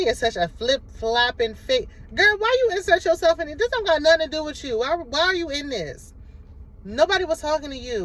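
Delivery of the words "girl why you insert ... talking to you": high, heated call-out; exasperated rant. Real, not acted. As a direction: scold her like she crashed the party. Ranting at a cast member.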